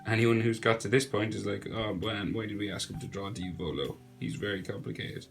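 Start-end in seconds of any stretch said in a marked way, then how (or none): none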